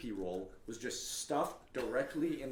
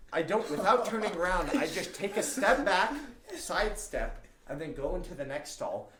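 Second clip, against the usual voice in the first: ordinary delivery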